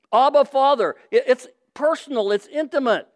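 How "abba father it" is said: normal talking voice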